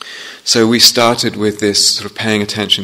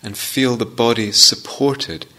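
guided meditation. Real, not acted. Teacher guiding students into upright posture, unhurried meditation voice. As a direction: ease each phrase out, leaving space after it.

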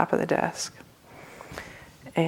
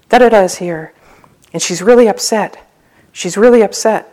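louder, urgent mock-panicked report; freaked-out receptionist; 3 semitones higher, 9 dB louder than usual. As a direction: imitate a flustered warning, pushing each phrase. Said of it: "the receptionist is totally freaked out".

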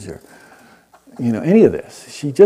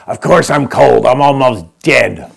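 slow, louder, blunt retort; feisty dying woman rebuffs the blanket; shooting back; 2 semitones higher, 9 dB louder, slower than usual. Retold or imitated. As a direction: snap it back with dark humor. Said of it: "Adele shot back".

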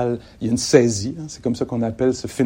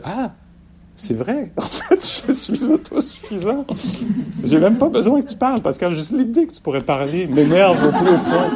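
discover it suddenly, half laughing; surprised realization; 7 semitones higher than usual. Retold or imitated. The student realizing, surprised, that they are self-sufficient. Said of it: "comme une surprise".

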